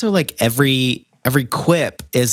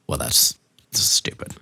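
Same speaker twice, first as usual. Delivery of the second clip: much softer, lower, whispered dismissal; whispered scoff; 12 semitones lower, 5 dB quieter than usual. Retold or imitated. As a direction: breathe it out like a scornful aside. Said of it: "I was like".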